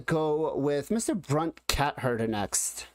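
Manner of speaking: ordinary delivery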